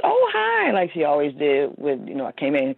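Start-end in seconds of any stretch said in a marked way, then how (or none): 0.0-0.7 bright mom greeting. Retold or imitated. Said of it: "she said".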